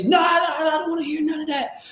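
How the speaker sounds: sing-song mock of her refusing to listen; mock sing-song refusal. Seller refusing to hear the agent, mocked high singsong. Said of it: "They cutting you off".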